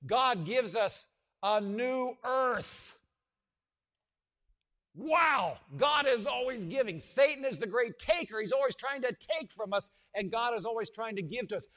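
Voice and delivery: triumphant pulpit shout of praise; preaching climax. Acted. Climactic proclamation.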